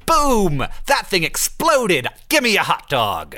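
high-pitched exclamations falling away; gleeful patriot act. Acted. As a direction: whoop it like a fireworks fan, drawn out. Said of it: "You wanna be like".

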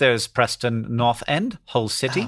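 put-on slow British newsreader accent; measured mock-BBC English accent. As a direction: read it out slowly in BBC English. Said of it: "this is like B B C voice".

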